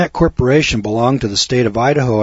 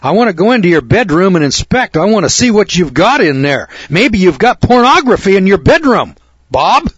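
loud, higher, demanding intrusion; raised voice; 8 semitones higher, 5 dB louder than usual. Pretend. Imagined rude guest raising his voice at his host. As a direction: bark it at him like a pushy inspector. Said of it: "I raised my voice to you and said".

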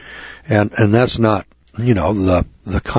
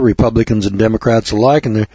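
ordinary talk

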